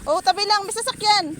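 loud car warning; shouting warning. Real, not acted. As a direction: yell to step aside. (malakas na sigaw ng babala)

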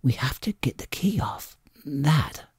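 hushed, breathless whisper of a frightened mother; urgent whisper. A narrator's reading. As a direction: whisper it so no one outside hears. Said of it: "she gave a kind of sob".

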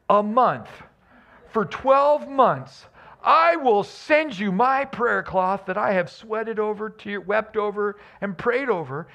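high, hammed-up TV huckster sales pitch; mock charlatan pitch. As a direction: sell the prayer cloth like a sleazy televangelist. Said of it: "charlatans take advantage of this".

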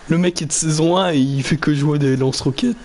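ordinary delivery

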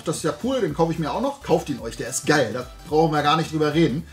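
ordinary delivery

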